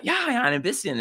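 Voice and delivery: quoted eager reply. Retold or imitated. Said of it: "I was like".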